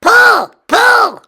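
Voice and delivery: high, loud troll cry; shrieking troll. A narrator's reading. Troll commanding the pull, high shriek. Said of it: "she cried".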